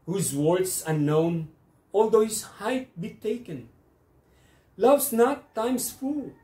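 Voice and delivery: measured verse reading that swells high in the middle, then settles; poem recitation. Acted. Host reciting a Shakespeare sonnet to online poetry viewers, declamatory. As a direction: declaim the sonnet with rising pitch, phrase by phrase.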